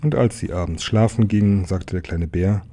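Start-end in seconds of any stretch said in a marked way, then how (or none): none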